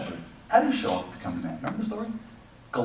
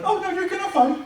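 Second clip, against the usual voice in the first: high mock insistence. God insists they'll fight.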